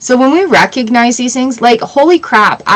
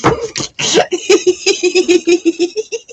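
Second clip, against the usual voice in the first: high giggle; 9 semitones higher than usual. Real, not acted. Keeps laughing.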